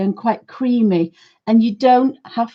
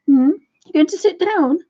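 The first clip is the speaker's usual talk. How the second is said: talking to the dog; 6 semitones higher than usual. Real, not acted. Owner coaxing her dog onto its bed, sing-song and high.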